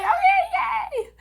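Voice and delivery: very high, laughing yay; giggly cheer. Retold or imitated. Daughter cheering the toilet install, high laughing yay. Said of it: "And I'm like".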